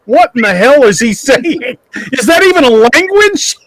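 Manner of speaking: high-pitched, laughing disbelief; mock-bewildered outburst; retold bafflement. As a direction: act out baffled incredulity, pitch climbing on the second question. Retold bafflement at thick accent.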